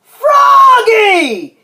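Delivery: loud, high calling yell; exasperated parent's shout; dad's yell. A narrator's reading. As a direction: holler the child's name, stretched out. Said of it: "yelled Dad".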